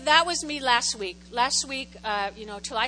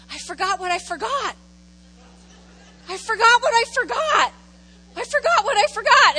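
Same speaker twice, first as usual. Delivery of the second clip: building from raised pitch to loud, high cry; mock-exasperated wail at yourself; retold frustrated outburst; 8 semitones higher, 7 dB louder than usual. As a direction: get louder and higher with each repeat. Retold frustrated outburst, repeated louder.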